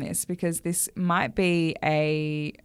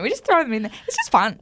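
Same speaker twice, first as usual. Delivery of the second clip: ordinary talk, nothing marked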